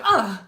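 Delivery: loud, short reenacted "ugh"; yelp of pain. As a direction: react as if just zapped. Retold reaction to laser zap.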